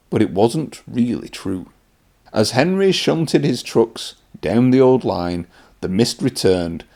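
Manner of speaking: ordinary delivery, nothing marked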